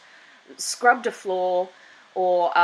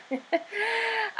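soft giggle; 13 semitones higher, 5 dB quieter than usual. Real, not acted. Laughs after joke remark.

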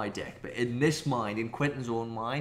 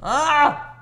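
high sharp cry of stress; frustrated shriek; 14 semitones higher, 12 dB louder than usual. Real, not acted. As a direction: yelp in panic. Frustrated during hard battle.

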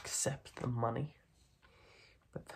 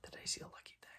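whispered tease; teasing whisper; 14 dB quieter, faster than usual. Acted. Mob boss pretending to give the kid his gun, teasing whisper. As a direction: lure him in softly.

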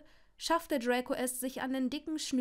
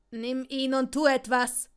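loud, urgent command; shouted order; 7 dB louder than usual. A narrator's reading. Draco ordering Longbottom as the spider nears, shouting. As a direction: call it out forcefully. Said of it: "schrie er".

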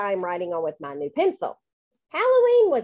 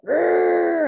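loud, higher drawn-out growl; scary clown growl; 8 semitones higher, 6 dB louder than usual. A narrator's reading. Kid clown trying to scare Cinderella, growling. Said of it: "I made a scary clown face".

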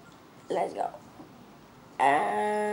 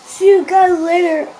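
loud, high cheerful sign-off; excited goodbye; 6 semitones higher, 14 dB louder, much slower than usual. Real, not acted. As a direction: shout the goodbye happily.

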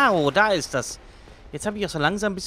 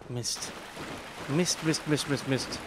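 cursing under breath; 7 dB quieter, slower than usual. Real, not acted. Muttering in frustration.

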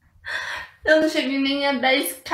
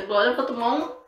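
ordinary delivery

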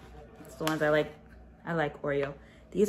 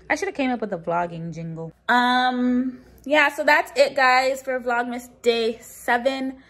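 normal talking voice

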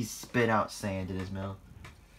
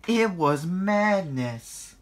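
loud, high, drawn-out exclamation; dramatic climax; 9 semitones higher, 8 dB louder, much slower than usual. A narrator's reading. Narrator topping off the engines' brawl, dramatically. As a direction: proclaim the chaos slowly and loudly.

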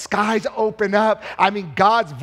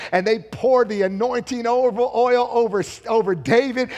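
ordinary delivery

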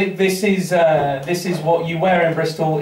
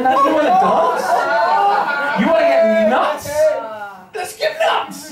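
hammed-up manic dare, rising high; mock fight challenge; 7 semitones higher than usual. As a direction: goad him into a fight, over the top.

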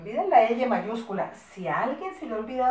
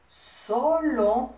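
two long syllables, louder; slow dictation; 5 dB louder than usual. Shown as a demonstration. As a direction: stretch "solo" out, rising at the end. Teacher dictating word by word.

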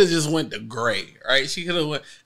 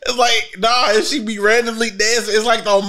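ordinary delivery

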